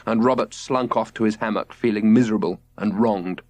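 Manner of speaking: plain reading voice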